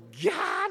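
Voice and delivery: high, loud mock shriek "ギャー"; wife's retold scream at the newt. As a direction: shriek like she found a newt in the vacuum. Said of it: "なんて言ってましたよ".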